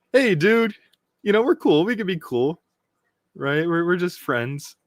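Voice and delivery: high-pitched buddy-buddy pleading, retold; friendly appeal. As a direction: play someone trying to make friends. Voicing a character's friendly overture.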